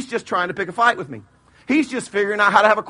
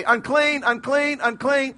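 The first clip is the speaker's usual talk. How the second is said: calling out; 5 semitones higher, slower than usual. Retold or imitated. Leper warning anyone within fifty feet, calling out high.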